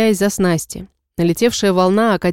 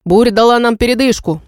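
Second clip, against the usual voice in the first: loud, strong captain's call; commanding shout; 4 semitones higher, 4 dB louder than usual. Acted. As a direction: cut through the silence with command. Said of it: "Сильный голос капитана". (громкий властный голос капитана)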